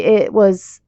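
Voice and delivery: usual speaking voice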